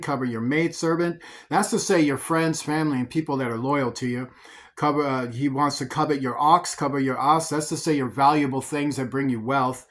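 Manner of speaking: ordinary talk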